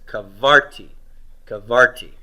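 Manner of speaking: kavarti with firm weight on VAR; stress on second syllable. Shown as a demonstration. Teacher gives the answer on accent placement, kavarti stressed on the middle syllable. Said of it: "I want it on root two".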